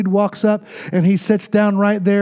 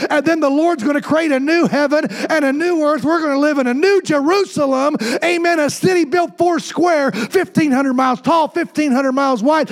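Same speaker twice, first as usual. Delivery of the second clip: higher, shouted revival cadence, building; preaching at full force; 6 semitones higher than usual. Acted. Pastor rallying the house about the millennium, fervent preaching. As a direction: sell the vision of the new Jerusalem.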